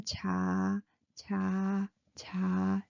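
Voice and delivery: low, drawn-out "cha"; low, even "cha" syllables; low pitched. Shown as a demonstration. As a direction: say "cha" slowly on a low note. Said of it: "low pitched".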